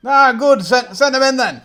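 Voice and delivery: put-on an old man thrilled his lad has come; hearty old gentleman. GM voices delighted old gentleman.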